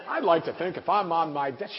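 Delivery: high, loud mocking voice, laughing; mock the boaster and cut him off; mocking whine.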